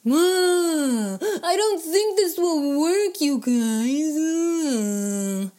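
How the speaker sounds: loud, high wail of complaint; whiny, drawn-out grumble; grumbling. A narrator's reading. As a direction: moan it out, sinking in pitch at the end. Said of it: "the friends were all grumbles".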